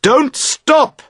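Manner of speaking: shout. A narrator's reading. Boat owner shouting to the girls on the bank. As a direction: call out across the water. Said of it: "You shout to them".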